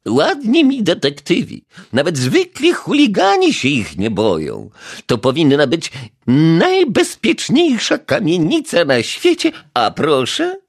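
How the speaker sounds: high, loud reproachful neighbour; scolding old lady. A narrator's reading. Neighbour berates the detective, high shrill reproach. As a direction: lecture the useless detectives.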